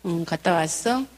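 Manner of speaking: low, unhurried, casual greeting; playing it cool. Mom greeting her child back in, coolly. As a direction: keep it soft and nonchalant. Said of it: "이제 쿨하게".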